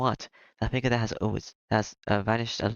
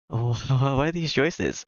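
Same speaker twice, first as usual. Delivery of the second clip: amused laugh; 4 semitones higher, slower than usual. Real, not acted. Amused at odd epilogue choices.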